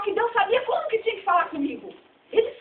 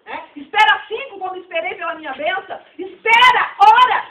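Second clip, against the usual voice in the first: louder and louder until the sermon peaks in a shout; shouting preacher; 10 dB louder than usual. Real, not acted. Preacher to the church, calling out wait in a loud shout. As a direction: hammer each wait at the congregation.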